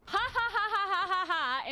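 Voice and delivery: high, punched-out mock laugh; loud staccato reading of written laughter; typed all-caps laughter. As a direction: read the laugh out as evenly hammered syllables. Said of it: "has just typed in capital letters".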